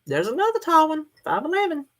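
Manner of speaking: high, excited note. Real, not acted. Excited about tall viewer.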